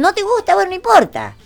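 high, airy brush-off; a light mock pout; teasing shrug. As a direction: toss it off with a shrug. Teasing dismissive reply.

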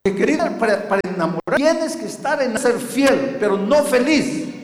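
loud, high pulpit proclamation; full-force preaching. Real, not acted. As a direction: drive it home to the congregation, loud and insistent. (predicación a voz en cuello)

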